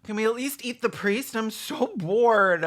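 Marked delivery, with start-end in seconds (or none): none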